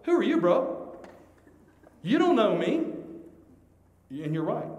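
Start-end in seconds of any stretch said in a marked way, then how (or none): 0.0-2.9 higher, louder imitated protest; mock indignation. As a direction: play the affronted listener talking back. Voices offended listeners' thoughts.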